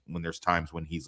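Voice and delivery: ordinary talk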